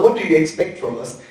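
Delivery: normal talking voice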